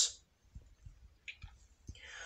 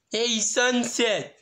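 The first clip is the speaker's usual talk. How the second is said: higher, drawn-out call; Snips calling out; 9 semitones higher, much slower than usual. Acted. Snips calling Sunset from across the rubble, higher drawn-out call. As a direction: call her name lazily.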